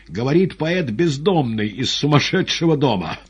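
high, loud, urgent orders; shouting into phone. A narrator's reading. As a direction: yell frantic orders down the receiver. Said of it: "закричал Иван в трубку".

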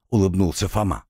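usual speaking voice

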